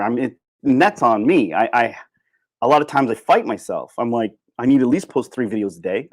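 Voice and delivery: ordinary talk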